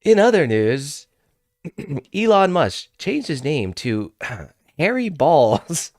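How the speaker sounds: a hint of cable-news announcer; news anchor imitation. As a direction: read it like a TV news headline, clearing the throat before the silly name. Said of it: "and C N N comes on".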